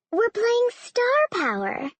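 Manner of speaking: high, drawn-out chirp; chirpy. A narrator's reading. Mother reminding a dinner guest of the family game, chirpy. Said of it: "Missus Hastings chirped".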